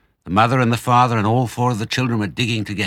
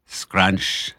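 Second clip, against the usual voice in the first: usual speaking voice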